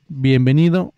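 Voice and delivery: usual speaking voice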